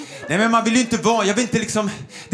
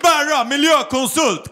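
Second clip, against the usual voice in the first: mock boastful consultant; 5 semitones higher, slower than usual. Mocking a boastful Stockholmer.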